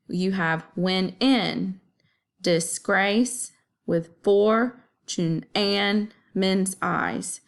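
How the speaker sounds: slow, da-DUM beat on each pair of syllables; scanning the line in iambs, slow and deliberate; iambic stress. Shown as a demonstration. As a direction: tap out the iambs slowly, stressing "in", "grace", "for", "and", "eyes". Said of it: "unstressed, stressed, unstressed, stressed".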